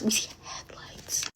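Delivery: very soft, slow whisper; suspenseful voiceless whisper; hushed whisper. Retold or imitated. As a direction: whisper it slowly, as if hiding. Hiding, repeats it in a whisper.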